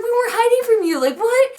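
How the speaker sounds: high-pitched, incredulous retold protest; indignant protest. Teen blamed by family for hiding, indignant high protest.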